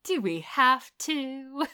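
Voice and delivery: soft, drawn-out mock complaint; dragging, half-voiced protest; reluctant whine. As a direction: imitate a reluctant man, slowly and quietly. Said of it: "Roger is the one who's like".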